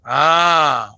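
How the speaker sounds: exasperated shout. Real, not acted. Exasperated outburst at student.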